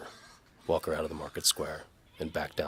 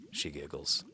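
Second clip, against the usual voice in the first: ordinary delivery, nothing marked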